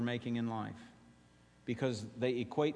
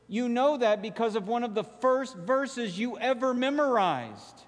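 higher and louder, calling out to the congregation; rousing preaching; 9 semitones higher, 4 dB louder than usual. Acted. Pastor prompting the congregation through John 3:16, rising fervor. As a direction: press the crowd for the answer. Preaching builds to call-and-response.

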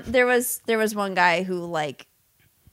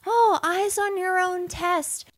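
exam proctor; 7 semitones higher, slower than usual. Retold or imitated. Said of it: "the scene where he's taking the test".

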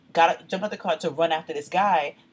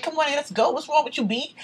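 higher-pitched husband urging her along; imitated exasperated scolding; 9 semitones higher, 3 dB louder than usual. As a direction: act out hustling someone away. Retold husband pulling her away.

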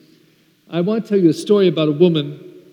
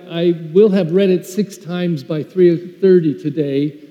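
ordinary delivery

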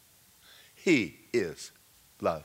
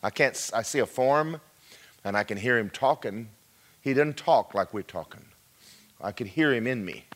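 ordinary talk, nothing marked

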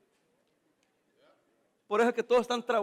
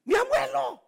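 mock disbelief; 11 semitones higher, slower than usual. Punchline, high voice.

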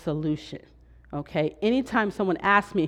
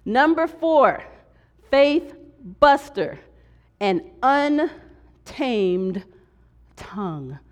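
dramatic announcement; 5 semitones higher, much slower than usual. Real, not acted. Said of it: "go on to the next slide and look at number four".

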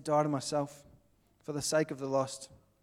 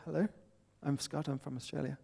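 reserved introduction; 9 dB quieter than usual. Acted. Missionary introduces himself to a stranger, quiet and restrained. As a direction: introduce yourself politely and quietly. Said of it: "instead of".

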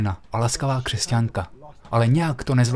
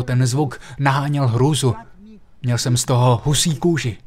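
normal talking voice

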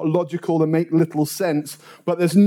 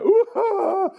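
imitated crying scream. Lad curled up on the drive, crying out. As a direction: wail high as if begging for attention. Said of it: "the screaming, crying at the top of my voice".

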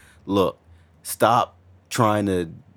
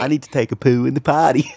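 "I need to take a poo in the potty" in a high, loud, laughing mock British accent; 6 semitones higher, 6 dB louder, faster than usual. Shown as a demonstration. Co-host repeating the line for the host, in a laughing mock British accent. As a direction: ham up a posh British accent. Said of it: "it sounds great if it's in British".

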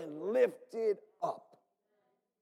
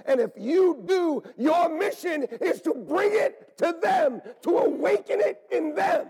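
louder and higher, rising call; preaching shout; 9 semitones higher, 8 dB louder than usual. Real, not acted. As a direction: fire them up for their mission. Preaching climax.

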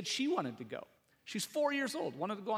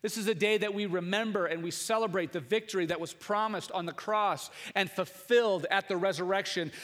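usual speaking voice

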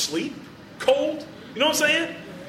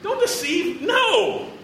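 mock warning; 13 semitones higher, much slower than usual. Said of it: "A little revised translation".

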